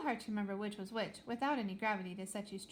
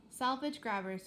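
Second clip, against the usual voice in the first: usual speaking voice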